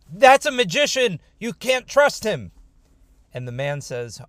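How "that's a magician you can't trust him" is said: loud, high-pitched shout of accusation; yelling. Acted. Yaakov warning nearby people about the stranger, yelling. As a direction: yell a warning to everyone nearby. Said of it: "Yaakov yells".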